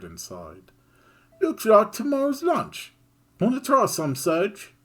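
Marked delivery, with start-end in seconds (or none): 1.4-4.7 high and louder, cheeky offer; Kid's voice. Acted. Kid offering Serge tomorrow's lunch, cheeky and high. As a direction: tempt him with the food.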